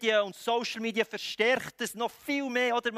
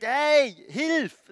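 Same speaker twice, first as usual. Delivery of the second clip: loud, high cry for help; 7 semitones higher, 9 dB louder than usual. Acted. Blind beggar crying out to Jesus for help, loud shout. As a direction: call out urgently from the roadside. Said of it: "fährt an zu schreien".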